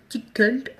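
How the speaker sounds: French-accented second attempt at English "tickled"; retry "tickled" in unsure French-accented English; tentative English "tickled" from a French speaker; bad English accent. Real, not acted. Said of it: "désolée pour mon accent pourri".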